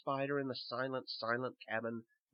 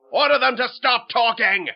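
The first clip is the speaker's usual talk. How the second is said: high, loud angry king; imperious shout; shouted royal command; 10 semitones higher, 17 dB louder than usual. Acted. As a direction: yell the order like a tyrant. King orders his minister.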